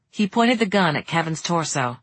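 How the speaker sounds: ordinary delivery, nothing marked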